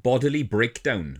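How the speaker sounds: ordinary talk, nothing marked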